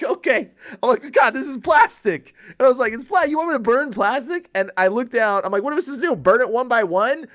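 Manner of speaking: laughing disbelief. Retold or imitated.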